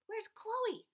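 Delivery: anxious high call, falling at the end; worried asking. A narrator's reading. Mom searching for her missing daughter, worried. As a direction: ask with rising worry. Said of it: "Mom asked".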